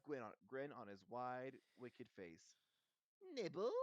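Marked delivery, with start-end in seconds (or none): 3.3-3.7 high, loud, sugary poltergeist; sweet offer. A narrator's reading. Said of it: "he said sweetly".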